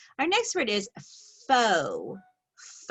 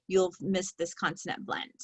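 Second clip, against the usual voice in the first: usual speaking voice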